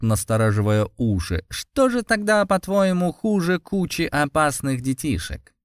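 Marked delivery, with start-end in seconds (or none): 1.5-5.5 cat spirit voice. Acted.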